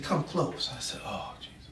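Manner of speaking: quiet plea in the paddy wagon; hushed prisoner. Retold or imitated. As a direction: lean in and murmur. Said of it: "He said".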